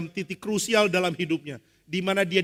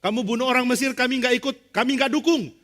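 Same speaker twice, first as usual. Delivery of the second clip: raised, high-pitched disowning; firm refusal; 7 semitones higher, 4 dB louder than usual. Acted. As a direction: push away all responsibility. Israelites refusing to support Moses. (menolak tegas dengan nada tinggi)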